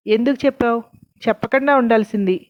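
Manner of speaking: plain reading voice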